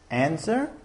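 ordinary delivery

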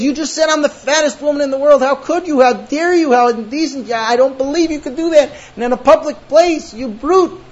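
high, loud, hammed-up outrage; mock bawling. Wife to husband over the tight dress, mock bawling outrage. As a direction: wail it in exaggerated wounded indignation. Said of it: "then she goes bawling and she says".

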